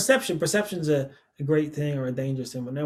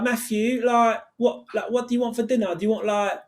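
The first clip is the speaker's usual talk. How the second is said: higher-pitched London accent; 6 semitones higher than usual. Shown as a demonstration. Guest demonstrating London, asking Matthew about dinner, London accent. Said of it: "a London one now".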